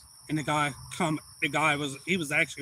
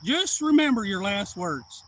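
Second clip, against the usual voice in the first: slow, louder, heavy menace; repeated threat; 5 semitones higher, 6 dB louder, slower than usual. Retold or imitated. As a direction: spell it out slowly like a final warning. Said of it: "I said".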